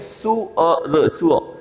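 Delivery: सु अ र drawn out, then joined as सु अ; split-up pronunciation. Shown as a demonstration. Teacher using the Hindi word for pig as a guide, slowly enunciated. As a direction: say सु अ र slowly in pieces, then drop the र. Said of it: "पिक को हिंदी में क्या बोलते हैं?".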